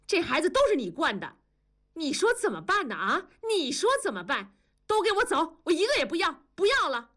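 high-pitched angry outburst; furious mother. A narrator's reading. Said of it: "文丽就大怒". (大怒地吼丈夫和孩子)

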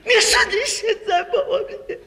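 high, loud hammed-up bawling; crying wife. Wife fails to finish divorce threat, crying. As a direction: break down into stammering, unintelligible wails. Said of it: "就别哭了".

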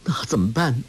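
a touch of a whimper; helpless, understated whimper. A narrator's reading. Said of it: "呜咽着说".

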